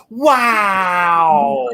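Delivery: long, drawn-out mock-amazed wow; exaggerated "wow" gliding slowly down; awestruck kid imitation. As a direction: stretch it out in hammed-up wonder. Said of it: "and he'd be like".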